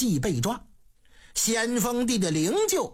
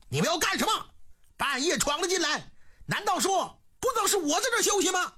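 higher, fast angry scold from a startled official; 9 semitones higher, much faster than usual. A narrator's reading. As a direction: bluster in outrage, faking calm. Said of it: "大骂起来". (高声怒骂)

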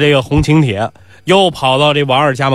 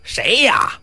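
high, hoarse call; hoarse gambler's voice; 8 semitones higher, 4 dB quieter than usual. Acted. Doorman answering Nisi's call, hoarse from gambling. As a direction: call out through a voice worn out by shouting. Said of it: "是赌博时候是喊哑的".

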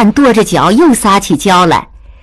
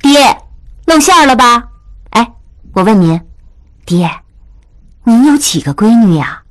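a bit cross daughter; 5 semitones higher than usual. A narrator's reading. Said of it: "有点生气".

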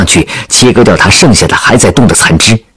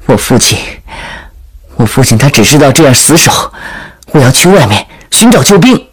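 put-on higher-pitched, insistent young leader; earnest princess; 5 semitones higher than usual. Princess persuading her veteran friend, earnest higher voice. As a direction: press your case in a raised pitch.

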